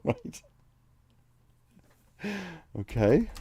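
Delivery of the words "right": laughing. Real, not acted. Laughs at game text.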